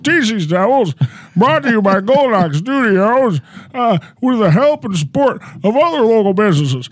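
comic impersonation of a rambling president; Joe Biden. Acted. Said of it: "It's your favorite president".

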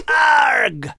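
loud high pirate arg; loud mock pirate arg; pirate growl. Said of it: "Are you a pirate again".

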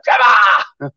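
high, loud exasperated shout; mum's shout. Retold or imitated. Mum yelling at the police-visited son, loud and high. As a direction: yell it at him, fed up. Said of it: "She goes".

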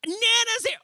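high-pitched squeal of joy; mock little-boy shriek; excited kid. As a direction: imitate a child too thrilled to listen. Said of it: "He's all so excited".

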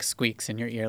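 ordinary talk, nothing marked

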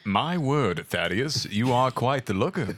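flirt like a Victorian gentleman; mock posh British. Friend joining in with a posh British gentleman voice.

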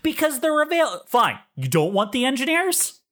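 high-pitched, flustered comeback; exasperated retort. Acted. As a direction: cut yourself off in acted exasperation. Acted quarrel with the angry caller.

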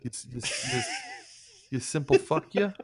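high, sputtering Boston insult; mock Boston insult.

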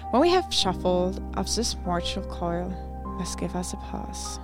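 normal talking voice

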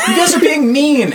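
put-on very high, loud childish wail; whining kid. Game master as the kid to bullies, whining kid. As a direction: squeal it as a picked-on little boy.

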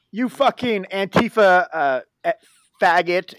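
halting, forceful retold abuse; retold angry cursing. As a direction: imitate an angry man cursing down the phone. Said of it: "started yelling at me and cursing at me".

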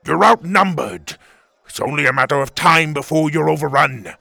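loud villain's threat; yelling over noise. Acted. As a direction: call out the threat over the din. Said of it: "Tuga yelled over the noise".

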